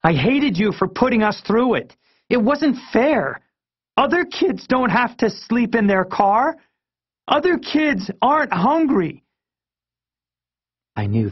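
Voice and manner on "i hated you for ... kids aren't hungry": put-on very high-pitched, upset kid tirade; escalating angry outburst. A narrator's reading. Kid blaming parents for hardship, angrily escalating. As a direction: let the pitch climb as the accusations pile up. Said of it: "my voice getting louder with each word".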